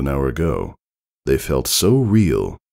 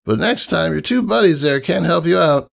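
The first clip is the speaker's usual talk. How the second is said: high, cocky tomboy voice; Rainbow Dash voice; 13 semitones higher than usual. Acted. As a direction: tease her like a brash young flyer.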